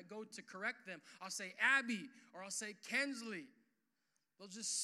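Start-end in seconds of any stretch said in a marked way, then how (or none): 1.6-2.1 sharp, louder calling of the name; firm name call. Retold or imitated. Parent correcting screaming baby. 2.9-3.5 sharp, louder calling of the name; firm name call. Retold or imitated. Parent correcting screaming baby.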